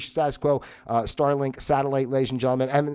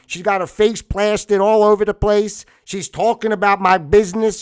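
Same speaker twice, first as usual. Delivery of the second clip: aggrieved tough guy, louder and higher; a wound-up local boss airing grievances; upset Vincenzo; 5 semitones higher, 6 dB louder than usual. Acted. Vincenzo complains about his mother.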